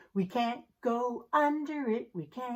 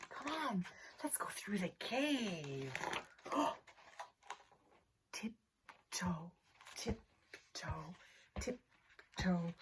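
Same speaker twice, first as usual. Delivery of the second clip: much softer, hushed and careful; hushed tiptoe; 6 semitones lower, 14 dB quieter than usual. Pretend. Teacher sneaking the class through the cave, hushed. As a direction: creep the words along on tiptoe.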